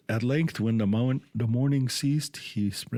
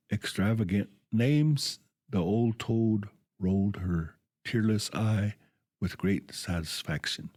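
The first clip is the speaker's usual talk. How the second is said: ordinary delivery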